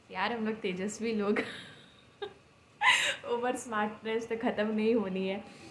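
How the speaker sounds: laughing. Real, not acted. Joking about overconfidence.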